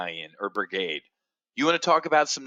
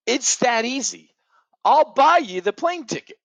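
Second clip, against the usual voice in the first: mocking taunt; 6 semitones higher than usual. Taunting war supporters online.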